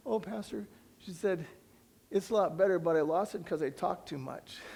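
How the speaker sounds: cheerful report. Retold or imitated. Girl reports lost voice happily.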